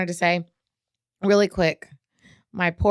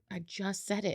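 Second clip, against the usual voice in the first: muttered; 14 dB quieter than usual. Retold or imitated. Retold reply, under breath.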